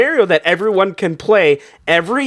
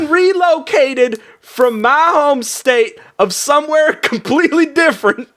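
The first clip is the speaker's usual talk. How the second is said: put-on laughing while holding the Southern accent; 7 semitones higher than usual. Border inspector explaining his move, in a laughing Southern accent. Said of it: "why I have this accent in California".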